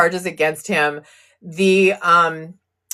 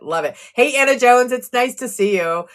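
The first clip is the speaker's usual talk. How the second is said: ordinary talk, nothing marked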